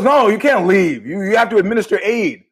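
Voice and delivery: emphatic refusal. Retold or imitated. Doctor friend tells him he can't leave, insistent. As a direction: forbid it firmly, voicing the friend on the phone.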